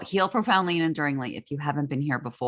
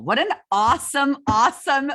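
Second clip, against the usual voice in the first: excited; 10 semitones higher, 6 dB louder than usual. Real, not acted. Gushing over the bio.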